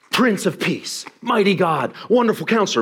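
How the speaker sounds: high-pitched, grand roll of titles; gutsy divine proclamation. Acted. Preacher imagining God's grand announcement, gutsy proclamation. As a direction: proclaim each title with full guts. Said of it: "given it the guts".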